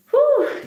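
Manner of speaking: high, loud drawn-out whew. Retold or imitated. Exclaims over how long crying lasted.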